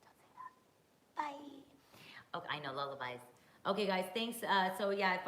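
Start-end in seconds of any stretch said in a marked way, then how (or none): 0.0-2.4 squeaky, drawn-out breathy whisper; high whisper. Pretend. High airy repeat. 2.4-3.2 whispering. Real, not acted. Whispered reply to chat.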